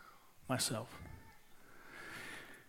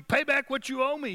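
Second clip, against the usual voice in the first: high, loud, furious demand; unforgiving servant shouting for his money, high and loud; angry demand; 7 semitones higher, 4 dB louder than usual. A narrator's reading. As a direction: bark it at the debtor as you choke him. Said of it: "he demanded".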